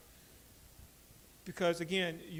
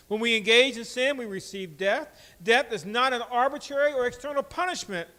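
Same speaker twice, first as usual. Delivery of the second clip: loud, raised sermon voice, driving each phrase home; full-force preaching; 5 semitones higher, 12 dB louder than usual. Acted. Pastor warning the congregation about sin, loud preaching.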